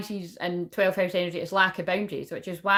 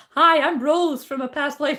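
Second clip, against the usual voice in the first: higher, louder, slow goofy bloke voice; mock bloke greeting; 7 semitones higher, 7 dB louder than usual. A woman mocking a stranger's cheesy pickup line, higher and louder. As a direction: ham up a stranger's cheesy intro. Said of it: "be like".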